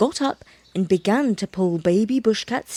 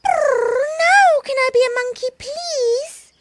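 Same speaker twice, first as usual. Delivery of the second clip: squeaky pleading kitten, high pitched; 14 semitones higher, 5 dB louder than usual. Acted. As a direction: beg like a little kitten. Kitten asks.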